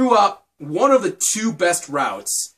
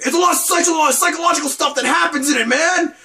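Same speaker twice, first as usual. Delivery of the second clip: high, loud mock-hype voice; imitating insistent fans; 11 semitones higher, 4 dB louder, faster than usual. Fans selling a visual novel to a friend, high mock excitement. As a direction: rush it out high like an overexcited fan. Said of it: "And they're just like".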